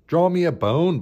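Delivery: a hint of a storybook dog's bark. A narrator's reading. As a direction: lightly bark it as an eager dog. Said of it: "barked Bruce".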